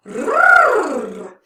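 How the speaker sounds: loud high rolled brrr. Shown as a demonstration. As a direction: roll the R hard and bright. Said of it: "or a rolled R".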